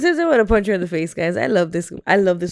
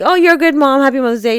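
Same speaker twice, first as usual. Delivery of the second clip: high, lilting mock greeting, easing down; perfunctory cheer; 6 semitones higher, 4 dB louder, faster than usual. Host imitating blanket Mother's Day wishes, perfunctorily cheery. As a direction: toss off a routine compliment.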